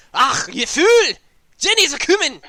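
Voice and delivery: high, loud Scottish little man; Scottish accent. Acted. Little blue man warns the players, high Scottish shout. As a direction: cry a frantic warning in broad high Scottish. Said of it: "It's Scottish".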